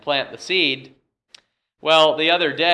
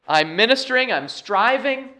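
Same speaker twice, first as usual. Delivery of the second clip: high, insistent list building in emphasis; voicing Paul's appeal; 5 semitones higher than usual. Preacher speaks as Paul to the church, insistent. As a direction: press each clause home in a raised pitch. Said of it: "Paul is saying here".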